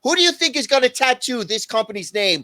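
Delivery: loud, high, scoffing disbelief; incredulous outburst. Retold or imitated. Retelling own reaction to company's tattoo plan, loud mocking disbelief. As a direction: blurt it out like the idea is absurd.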